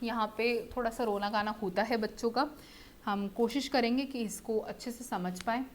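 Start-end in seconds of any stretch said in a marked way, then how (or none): none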